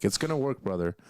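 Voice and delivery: ordinary talk, nothing marked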